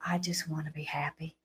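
hushed, breathy, with no voice at all; whisper. Retold or imitated. A frightened teen whispering her answer to the booming voice. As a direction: barely breathe the words, timid.

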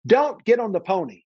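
put-on a voice cautioning the boy gravely; warning voice. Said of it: "here's what the voice said".

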